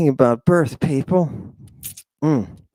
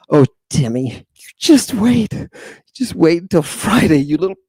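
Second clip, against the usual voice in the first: put-on higher, louder mom threatening her kid; hammed-up mother warning her son; mock scolding mother; 4 semitones higher, 4 dB louder than usual. Mother warning little Timmy to just wait until Friday, in a mock scolding voice.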